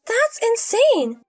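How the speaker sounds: appalled. Acted. Elia hears about drinks with the gambler, appalled. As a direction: stretch each word in scorn.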